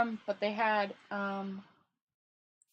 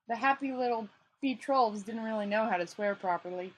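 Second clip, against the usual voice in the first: ordinary delivery, nothing marked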